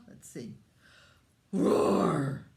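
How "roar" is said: big loud roar; dragon roar. Acted. Parent leads child in a loud dragon roar.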